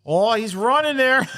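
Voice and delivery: high, slow, put-on voice; mock surprised realization. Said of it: "She's like".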